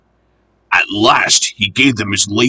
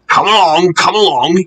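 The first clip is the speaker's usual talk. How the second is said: higher, rhythmic puffing call; puffing engine; 6 semitones higher than usual. A narrator's reading. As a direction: chug it out like a steam engine. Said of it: "he puffed".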